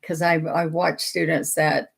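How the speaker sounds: ordinary delivery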